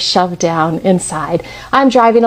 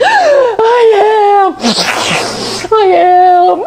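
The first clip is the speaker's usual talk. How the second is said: ugly crying; 11 semitones higher, much slower than usual. Retold or imitated. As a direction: choke it out through tears. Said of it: "I am full on ugly crying".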